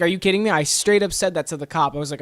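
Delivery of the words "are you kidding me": raised, louder pitch of disbelief; incredulous protest. Retold or imitated. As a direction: throw it at the cop in disbelief. Acts out protest to cop.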